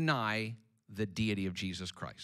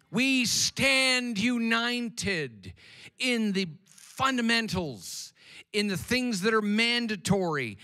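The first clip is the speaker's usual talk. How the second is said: louder, higher, slow rallying shout; bigger shout; 12 semitones higher, 9 dB louder than usual. Real, not acted. Preacher answering his own call for a bigger shout, full shout. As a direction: give them the bigger shout they asked for. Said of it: "I needed a bigger shout than that".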